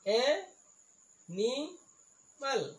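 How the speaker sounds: "এ-, নি-, মাল" as isolated rising pieces; syllable by syllable. Shown as a demonstration. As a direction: sound out "এ-, নি-, মাল" with gaps. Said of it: "ইয়াত তিনিটা চিলেবুল আছে". ("এ-, নি-, মাল" ভাঙি ভাঙি)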